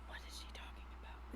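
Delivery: whispered aside to the table. Real, not acted. Player whispering to the table about the noblewoman. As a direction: hush it so she won't hear. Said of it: "aside to the party".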